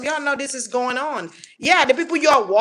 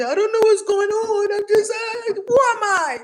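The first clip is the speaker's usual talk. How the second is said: much higher, lost and bewildered mock voice; confused senile person; 11 semitones higher than usual. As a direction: play someone losing their mind, pitched way up. Mocking people going senile.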